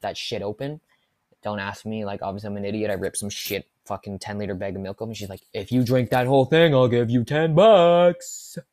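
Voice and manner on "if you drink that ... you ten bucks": loud, slow taunting dare; mock dare; daring. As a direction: dare him loudly. Girl daring with milk.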